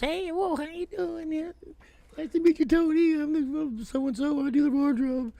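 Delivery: high, breezy woman's voice; wardrobe lady impression. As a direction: play the chirpy wardrobe girl, way up high. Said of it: "She goes".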